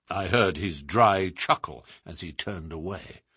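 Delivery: ordinary delivery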